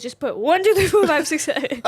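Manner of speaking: loud, high retold comic outburst; comedian's exasperated yell, imitated while amused; Bill Burr. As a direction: rattle off the digits in a mock shout. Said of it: "He goes, he goes".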